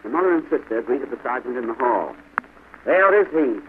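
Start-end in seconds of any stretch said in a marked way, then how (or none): 2.8-3.6 loud, higher accusing call; sergeant's bellow. A narrator's reading. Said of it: "bellowed the sergeant".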